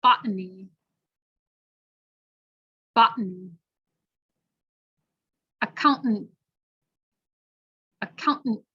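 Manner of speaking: "Botany" and "accountant" with throat-stopped T; careful glottal T; glottal T drill. Shown as a demonstration. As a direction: swallow the T, hold the last T. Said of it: "multi-syllable".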